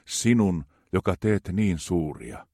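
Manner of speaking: ordinary talk, nothing marked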